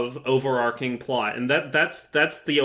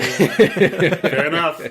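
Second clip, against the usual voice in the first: slow words through a laugh; 3 semitones higher, 5 dB louder, much slower than usual. Real, not acted. Laughing reply to disturbing episode joke.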